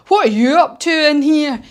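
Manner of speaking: lightly voiced bullying giant; giant's voice. Flesh Lump Eater confronts the BFG, mild gruff giant voice. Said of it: "he talks a bit like this".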